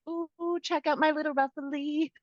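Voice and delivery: high, cooing squeal over a cute swimsuit; mock girly excitement. Hosts pretending to love a bathing suit, squealing girlishly. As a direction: gush like a giddy girl showing off a ruffly top. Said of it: "we were just like".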